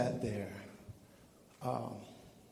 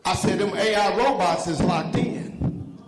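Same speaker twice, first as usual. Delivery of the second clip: loud, high sermon rhythm; preaching; 4 semitones higher, 8 dB louder than usual. Real, not acted. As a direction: preach it at full force. Preaching at full force.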